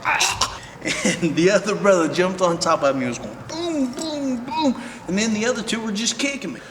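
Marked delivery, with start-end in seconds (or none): 0.1-0.6 high strangled yell; choked cry. Retold or imitated. Retelling being choked on the floor, strangled yell. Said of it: "I was like". 3.9-4.8 mock punch sounds. Imitating punches landing.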